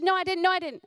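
high, loud insistent denial; child's protest. Retold or imitated. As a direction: deny it like a cheeky toddler. Child denying again.

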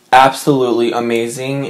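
ordinary talk, nothing marked

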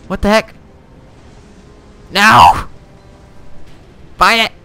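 loud, high panicked shouts; panicked yelling. Real, not acted. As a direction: scream in alarm as the ship sinks. Ship crashing, panicked yelling.

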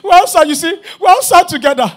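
real laughter breaking through; laughing. Real, not acted. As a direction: repeat it while still laughing.

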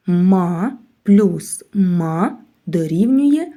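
Ма плюс ма spelled out very slowly; slow, deliberate. Shown as a demonstration. Teacher slowly builds a word from syllables. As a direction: drag each syllable like a sum. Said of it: "grammar arithmetic example".

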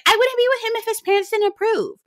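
high mock Larsa. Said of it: "She was like".

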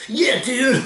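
high, loud fake enthusiasm through a laugh; mock cheering. Viewer ridiculing the show's protesters, laughing mock cheer. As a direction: whoop it like a hammed-up supporter.

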